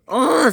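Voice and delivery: high, loud "ugh"; angry groan. A narrator's reading. Percy fed up with the locos, angry groan. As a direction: explode in a frustrated groan.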